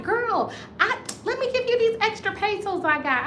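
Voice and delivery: put-on high, girly voice for her friend; high friendly girl voice; friend's voice. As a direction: chirp it like a sweet friend. Friend offering pesos, high voice.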